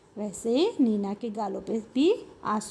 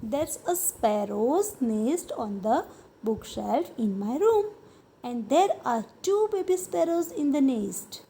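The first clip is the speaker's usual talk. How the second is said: slow reading; much slower than usual. Shown as a demonstration. Teacher reads Nina's words slowly.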